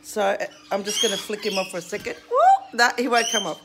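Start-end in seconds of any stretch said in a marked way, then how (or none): 2.3-2.8 sudden very high, loud woo; loud high whoop. Real, not acted. Owner whoops as she flicks the parrot off, high and loud.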